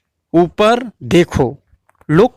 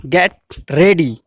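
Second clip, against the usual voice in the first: slow teaching demo; much slower than usual. Shown as a demonstration. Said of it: "तैयार हो जाओ".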